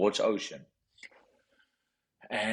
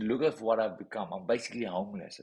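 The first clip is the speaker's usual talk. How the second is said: ordinary talk, nothing marked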